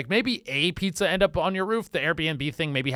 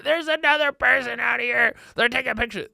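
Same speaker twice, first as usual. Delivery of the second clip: higher, put-on complaining woman's voice; whiny complainer; 8 semitones higher than usual. Brenda calling police about tourists, high whiny complaint. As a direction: tattle to the cops, high and whiny.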